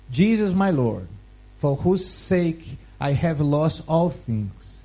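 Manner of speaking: ordinary delivery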